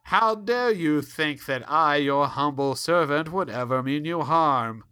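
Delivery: sarcastic humble-servant act. Acted. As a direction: lay on the fake humility.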